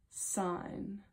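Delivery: ordinary talk, nothing marked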